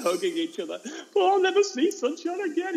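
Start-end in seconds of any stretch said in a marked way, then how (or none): 1.1-2.7 hammed-up high wail; squeaky mock sobbing kid voice; crying children. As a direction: bawl it like a heartbroken child. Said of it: "they're all crying tears".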